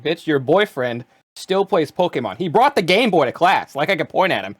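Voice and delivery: drawn-out, rising incredulous retort; slow, emphatic mock outrage; retold indignant comeback. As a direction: throw it back at her, slow and indignant. Acted retort in retold argument.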